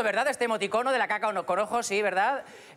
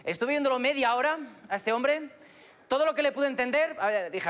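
usual speaking voice

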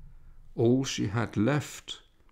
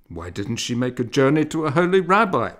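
loud, higher worried question; a frightened neighbor urging; anxious plea; 4 semitones higher, 7 dB louder, faster than usual. Acted. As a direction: press for an answer in fear. Frightened woman asks.

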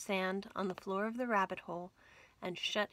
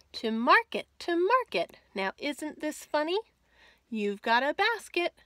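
cheerful louder rhyme reading; lively nursery rhyme; 3 semitones higher, 5 dB louder than usual. A narrator's reading. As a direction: bounce through the rhyme for children.

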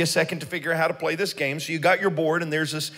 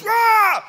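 sudden high mock roar; scare roar; 13 semitones higher, 4 dB louder than usual. Haunted house worker scaring Sage, mock roar. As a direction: jump out and scare them. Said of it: "up comes this guy and goes".

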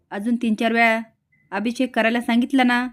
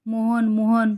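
understated cry of alarm, barely raised; mild narrated cry; much slower than usual. A narrator's reading. Mother calls out to Mohan after the crash, mild cry. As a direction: call out the name with a restrained yell. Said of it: "आईला मोठ्याने ओरडते".

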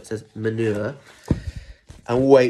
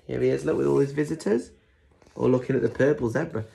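usual speaking voice

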